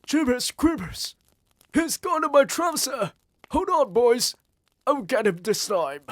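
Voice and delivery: high, loud frantic yelling; panicked shouting. A narrator's reading. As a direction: holler in alarm with a mouse in your trousers. Cook panics, mouse in trousers.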